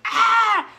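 very loud, high imitated shriek; mock scream. Friend frozen in shock by the pool, loud high shriek. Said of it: "and she was going".

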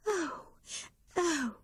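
mild dismay cry. A narrator's reading. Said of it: "cried Grey Rabbit".

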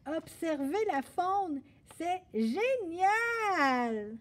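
high, loud, hugely drawn-out cheer; exuberant shout. Acted. Boy unable to hold his tongue any longer, exuberant shouting. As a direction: holler with glee, stretching the last word. Gaspard breaks silence, shouting joyfully.

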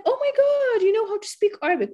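high-pitched gushing admiration; excited imitation of an impressed listener; proud amazement. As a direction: act out being thrilled and impressed, pitch way up. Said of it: "feeling very proud".

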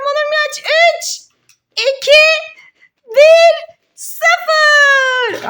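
rising countdown, high and loud; counting down loud. Acted. Mother counting down the son's timer, loud and high. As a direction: call out the final seconds.

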